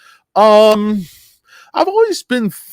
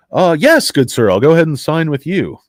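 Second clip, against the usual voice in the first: eager customer; 4 semitones higher, 6 dB louder than usual. Pretend. Customer voiced in skit.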